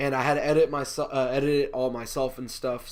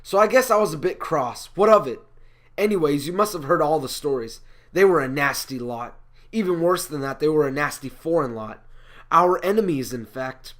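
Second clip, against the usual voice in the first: louder, indignant stage delivery; Jonah, cross and defensive; 2 semitones higher, 7 dB louder than usual. Acted. Jonah defending his anger about the nasty foreign lot, indignant. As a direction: punch out the grievances with mock crossness.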